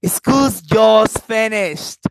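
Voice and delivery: loud, drawn-out delight; happy. Shown as a demonstration. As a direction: announce it slowly with big happiness. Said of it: "or happiness".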